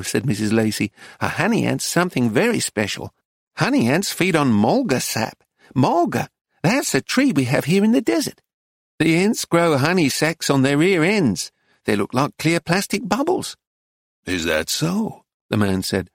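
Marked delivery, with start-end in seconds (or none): 1.2-13.6 higher-pitched, eager dealer; woman dealer's lilting higher voice; explaining shopkeeper. Acted. Dealer lectures the tourists. 14.2-15.2 low, slow tourist; American husband's lower drawl; drawling American man. Acted. Reader plays American man responding.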